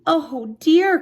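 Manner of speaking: high, loud little bunny voice; little bunny cry. Acted. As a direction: squeak it out in alarm. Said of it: "cried the littlest bunny".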